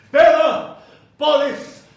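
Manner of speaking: loud, high commanding call; summoning call. Acted. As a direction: call the hounds from far off. Summons hellhounds.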